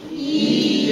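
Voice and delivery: 一 held long, level and loud; long first tone. Shown as a demonstration. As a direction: stretch 一 out high and steady. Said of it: "Longer, louder".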